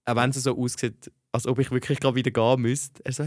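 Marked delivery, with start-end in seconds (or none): none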